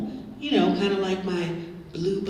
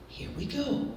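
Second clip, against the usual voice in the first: normal talking voice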